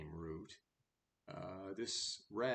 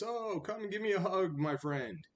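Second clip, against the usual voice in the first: much higher, louder, fast sugary coaxing; coaxing cat; 13 semitones higher, 9 dB louder than usual. Acted. Cat sweet-talking the rat out of his hole, high and fast coaxing. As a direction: lure him in with a sweet high false-friend voice.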